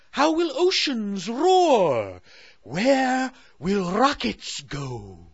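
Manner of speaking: high, slow troubled royal voice; fretting Moon Queen. Acted. Queen fretting to Sally about the moon, high and slow. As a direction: worry it out loud like a queen.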